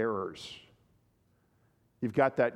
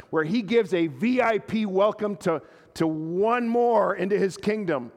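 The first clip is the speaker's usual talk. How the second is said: higher and louder, building sermon climax; full-force preaching; 6 semitones higher, 4 dB louder than usual. Acted. Pastor urging the congregation about Jesus' welcome to outcasts, impassioned preaching. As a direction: preach it with mounting fervour to the congregation.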